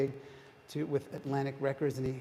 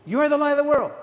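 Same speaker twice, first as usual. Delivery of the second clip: imitating a booming shout, much higher and louder; 12 semitones higher, 15 dB louder, faster than usual. Congregation telling the person next to them, booming. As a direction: boom it out to the person beside you. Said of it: "it was booming".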